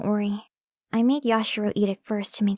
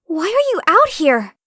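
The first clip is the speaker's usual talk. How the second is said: loud and higher, caught off guard; startled scolding; 7 semitones higher, 10 dB louder than usual. Acted. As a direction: call out in surprise at him. Catches him on the balcony.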